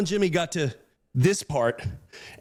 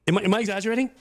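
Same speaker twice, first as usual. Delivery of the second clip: usual speaking voice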